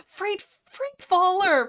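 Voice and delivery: gasping, high delighted greeting; excited shout-out. Real, not acted. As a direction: call out the name loudly, thrilled and drawn out. Excited greeting of a viewer's message.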